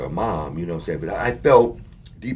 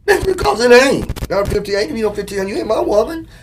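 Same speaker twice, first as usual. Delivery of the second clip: heated refusal; 10 dB louder than usual. Retold or imitated. Man telling the girl she gets no money, loud. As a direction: put your foot down hard.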